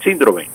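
síndrome as SÍN-dro-me; first-syllable stress in síndrome; esdrújula stress. Shown as a demonstration. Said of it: "palabra esdrújula".

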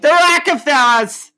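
very high, loud, hammed-up cry; exaggerated yell. Shown as a demonstration. Podcaster wishing he were rich, hammed-up yell. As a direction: belt the name out grandly. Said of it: "I've been talking like that all night".

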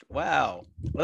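ordinary talk

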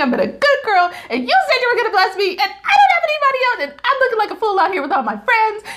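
high, fast, laughing whiny rant at Jesus; mock tantrum. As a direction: whine it out like a kid throwing a fit, half laughing. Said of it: "I had a big old tantrum".

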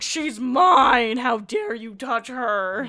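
yelled out loud in possessive fury; jealous furious shout. Retold or imitated. Luke catching Garrett with Ashley, yelling in furious jealousy. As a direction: bellow it like a jealous killer who just caught them.

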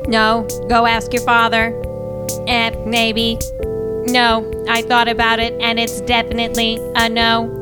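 recorded voice. Acted. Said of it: "three programmable buttons".

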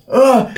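high panicked groan; a high, acted yelp of dread; freaked-out gasp. Game master as soldier watching the explosion, freaked-out groan. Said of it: "starts freaking out".

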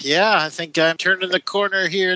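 ordinary talk

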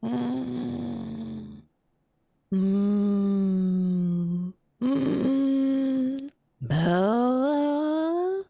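long moans rising into a question; moan, then swoop high on the name; eerie, high drawn-out creature call; raspy, windy shadow creature. Acted. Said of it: "you hear that long, raspy, windy voice".

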